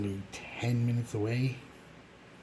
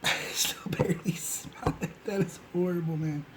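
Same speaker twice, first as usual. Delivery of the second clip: disgusted; 8 semitones higher than usual. Real, not acted. Reacting to a weak cologne.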